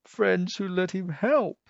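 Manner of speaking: squeaky squirrel yelp. A narrator's reading. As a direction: agree in a high little yelp. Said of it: "yelped the squirrel".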